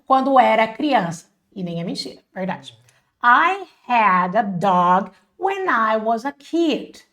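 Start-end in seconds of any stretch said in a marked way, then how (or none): 3.2-7.0 very slow, evenly spaced English; slow model sentence. Shown as a demonstration. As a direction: say it slowly for learners to follow. Teacher models English sentence twice.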